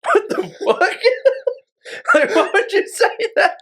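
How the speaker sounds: high-pitched words breaking through real laughter; can't get the question out for laughing; laughing in disbelief. Real, not acted. Retelling a coworker's joke, cracking up while asking why. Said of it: "I'm, like, dying as I hear this".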